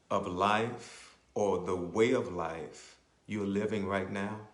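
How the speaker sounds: ordinary talk, nothing marked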